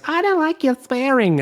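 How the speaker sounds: mock American objection. Australian host mocking an offended American, high prissy voice. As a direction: lampoon a prim American listener. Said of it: "the one that goes".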